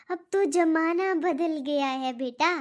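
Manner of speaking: ordinary delivery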